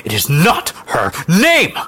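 slow, high, loud fury; furious denial. Acted. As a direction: deny it word by word in fury. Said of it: "Her eyes blazed with fury".

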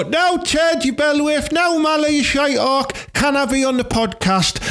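excited fan calling out. Pretend. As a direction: shout across the stand to your heroes. Fans hailing hosts at match.

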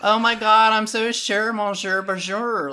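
put-on high Valley Girl voice, drawn out at the end; giggly mock Valley Girl French. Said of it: "heard a Valley Girl speaking French".

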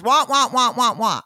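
loud droning wah wah, like a muted trumpet; dismissive mock whining. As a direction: make her excuse sound like meaningless noise. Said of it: "But all I heard was".

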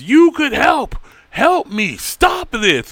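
high, loud, begging voice; desperate pleading. Acted. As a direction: beg the Watcher as the world ends. Steven begging the Watcher, acted.

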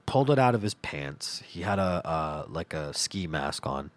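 normal talking voice

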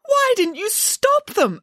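high, drawn-out incredulous question; aghast. A narrator's reading. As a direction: ask in slow disbelief. Nanny aghast, questions the girl.

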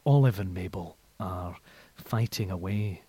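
low, soft, smooth dog commentary; Andrew Cotter impression. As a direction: narrate the dogs like a hushed sports call. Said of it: "your Andrew Cotter".

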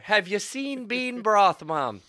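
loud, high, drawn-out challenge; mock indignation. Son defending bean broth to his mom, mock indignation. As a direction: challenge her with hammed-up outrage.

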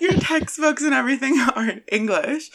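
laughing through the words. Real, not acted. Correcting her own slip to her friend, laughing.

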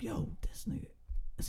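worried whisper. Retold or imitated. Worried about the friend in the back seat, whispering. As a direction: hush it, checking on the friend. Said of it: "So I'm like".

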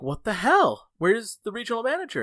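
high, exasperated retold outburst. Worker finds the manager absent, exasperated. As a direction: demand to know who's missing.

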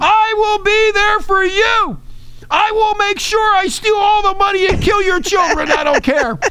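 squeaky villain caricature; high mocking caricature.

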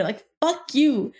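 normal talking voice

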